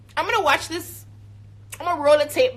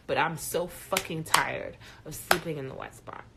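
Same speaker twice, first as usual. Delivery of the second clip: ordinary delivery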